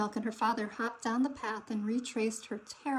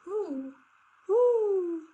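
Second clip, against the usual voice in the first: high little hooting call; baby barn owl calling softly; 8 semitones higher, 6 dB louder than usual. A narrator's reading. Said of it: "a baby barn owl who cried softly out".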